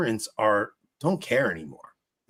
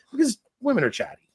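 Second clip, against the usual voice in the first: ordinary talk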